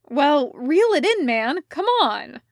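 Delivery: loud, eager push to reel it in; urging shout. Acted. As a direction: cheer him on, loud. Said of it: "Elliot told him".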